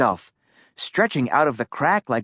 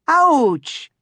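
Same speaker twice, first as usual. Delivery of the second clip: yelp; 13 semitones higher, 4 dB louder than usual. A narrator's reading. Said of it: "said Manny".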